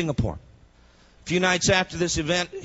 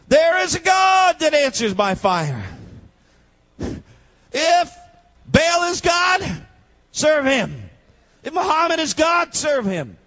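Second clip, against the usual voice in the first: much higher and louder preacher's shout; full-force preaching; 12 semitones higher, 6 dB louder than usual. Acted. Preacher challenging the congregation to choose their god, shouting. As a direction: hurl the challenge at the crowd.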